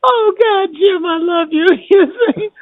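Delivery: high-pitched, through the tail of a laugh; laughing affection. Real, not acted. Guest to Jim after a shared joke, laughing affectionately. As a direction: say it while recovering from real laughter.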